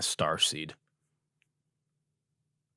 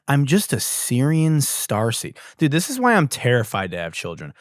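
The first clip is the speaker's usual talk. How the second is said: ordinary talk, nothing marked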